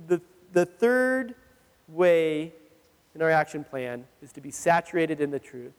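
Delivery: ordinary delivery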